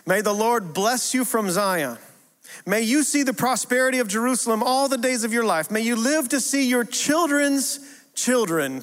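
high, ringing scripture reading. Real, not acted. Preacher reading verse five to the congregation, high and proclaiming. Reads a Bible verse aloud.

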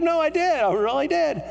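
hammed-up "you have to believe me"; insistent protest. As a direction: insist on it, pitch shooting up. Retold insisting to disbelievers.

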